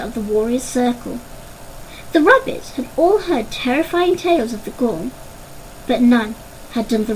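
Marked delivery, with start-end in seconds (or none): none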